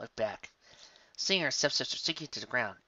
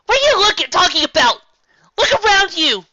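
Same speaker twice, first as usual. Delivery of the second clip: much higher, loud frantic shout; frantic outburst; 17 dB louder than usual. A narrator's reading. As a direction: Twilight cuts her off in disbelief. Twilight cuts in frantic.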